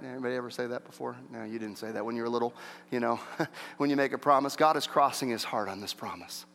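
usual speaking voice

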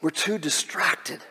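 ordinary talk, nothing marked

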